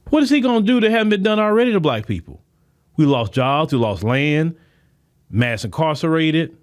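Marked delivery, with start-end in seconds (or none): 0.1-2.4 fast and higher, pressing the question; quick rising indignation; indignant question. Real, not acted. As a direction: fire it off like a challenge. Rhetorical question, first take.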